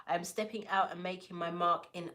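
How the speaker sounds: ordinary delivery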